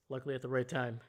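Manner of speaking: ordinary talk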